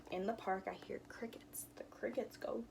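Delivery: softly whispered, barely there; whispering to listen for crickets. Shown as a demonstration. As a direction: whisper it like a secret. Quiet park sounds.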